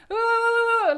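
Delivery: high loud open-mouthed "Ah" into the wind; loud high wind-blasted cry; headwind noise. Retold or imitated. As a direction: mimic cheeks flapping in a gale. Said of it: "our cheeks are going".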